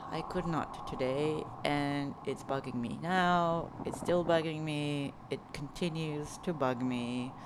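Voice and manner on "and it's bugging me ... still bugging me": normal talking voice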